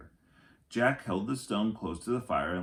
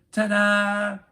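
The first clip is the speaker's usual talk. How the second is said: big bright high ta-da, much louder; excited ta-da; 12 semitones higher, 10 dB louder than usual. Acted. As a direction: present it like a magic trick. Annie shows off her coat.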